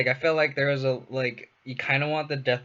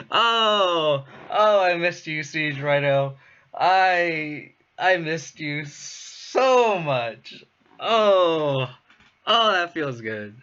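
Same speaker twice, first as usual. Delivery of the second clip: high loud overjoyed cries; joyful reunion; 5 semitones higher, 6 dB louder, much slower than usual. Real, not acted. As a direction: gush over a returned friend. Overjoyed at Rhino resolving.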